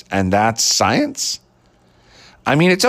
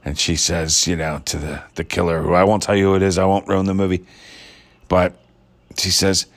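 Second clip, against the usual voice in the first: ordinary talk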